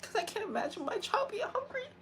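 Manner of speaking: quiet, pained crying; hurt, tearful. Real, not acted. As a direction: fight back real tears as you speak. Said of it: "That actually hurts".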